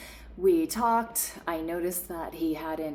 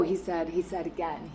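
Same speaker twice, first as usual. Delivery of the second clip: ordinary talk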